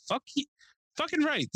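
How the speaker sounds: delighted approval. Retold or imitated. Acted delighted reaction to old neighbor.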